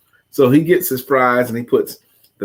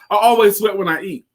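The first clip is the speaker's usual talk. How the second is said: deadpan coworker quote; 7 semitones higher than usual. Retold or imitated. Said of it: "That's what he said".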